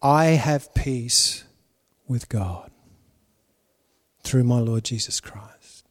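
whispered prayer of peace; quiet prayer. Shown as a demonstration. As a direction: pray it softly into your heart. The preacher's own prayer.

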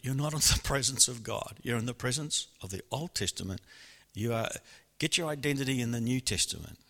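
normal talking voice

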